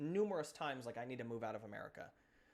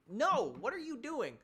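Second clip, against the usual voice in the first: exasperated outburst; 8 semitones higher than usual. Real, not acted. Ranting at complacent people.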